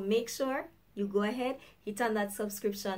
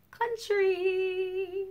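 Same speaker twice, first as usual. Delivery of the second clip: drawn-out flourish; 9 semitones higher than usual. Acted. Presenting her country.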